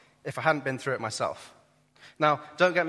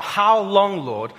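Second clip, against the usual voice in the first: loud, high anguished plea; loud, desperate cry to God; 6 semitones higher, 9 dB louder, much slower than usual. Acted. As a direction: call it out to heaven. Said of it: "he cries out".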